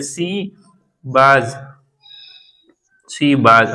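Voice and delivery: "she was" as see baaz; teased pronunciation. Shown as a demonstration. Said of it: "लोग मैंने देखा है कि टीज करते हैं". ("she was" को सी बाज़)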